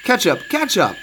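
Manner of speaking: high, sing-out call to a straggler. A narrator's reading. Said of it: "they always had to call".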